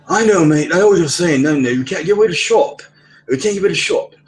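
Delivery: put-on high, stammering English accent; flustered put-on English accent. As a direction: imitate an excitable English-accented coworker, pitched well up. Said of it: "So he acquired an English accent".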